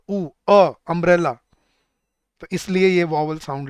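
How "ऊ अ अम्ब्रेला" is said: loud separate "ऊ", "अ", then "अम्ब्रेला"; isolated vowel sounds. Shown as a demonstration. Teacher showing why it takes an, "अ" isolated before "अम्ब्रेला". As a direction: punch "अ" alone as uh before "अम्ब्रेला". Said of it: "ऊ की sound है".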